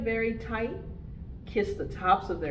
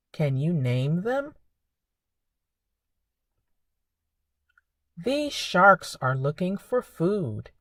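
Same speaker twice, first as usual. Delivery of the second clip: put-on reading to children; 7 dB louder than usual. Reads picture book to children.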